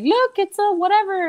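put-on high, proud little-kid brag; excited kid voice. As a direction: show it off like a proud child. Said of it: "I'd be like".

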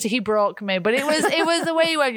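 normal talking voice

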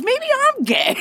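imitating mom. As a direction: lift way up into a playful voice for mom. Mom voiced in story.

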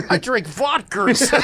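Long Island. Retold or imitated. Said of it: "how we say vodka on Long Island".

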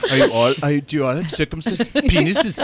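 a hint of a bad South African accent; terrible South African accent. Host mimics a South African dinner guest, bad South African accent. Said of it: "That's my terrible South African accent".

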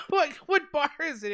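laughing. Real, not acted.

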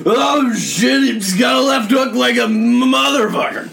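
shouted high wrestler growl; wrestling promo. Acted. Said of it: "doing a wrestling voice".

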